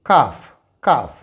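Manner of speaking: calf said as kaf, no l; silent l demo. Shown as a demonstration. Teacher showing students the silent l in calf. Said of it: "its pronunciation will be".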